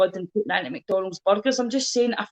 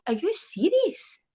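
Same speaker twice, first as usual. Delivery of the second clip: high, slow, soft incredulous squeak; disbelief; 9 semitones higher, 3 dB quieter, much slower than usual. Retold or imitated. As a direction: stretch "Are you serious?" up high in disbelief. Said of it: "And I'm like".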